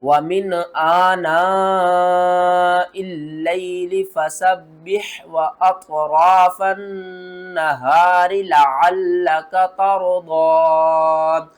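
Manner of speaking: slow tajweed chant with long held vowels; drawn-out Quran recitation. Shown as a demonstration. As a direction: recite slowly, stretch each madd fully. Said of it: "इसको खींच कर भी पढ़ना है". (खींच कर तिलावत)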